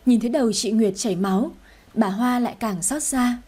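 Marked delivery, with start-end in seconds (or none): none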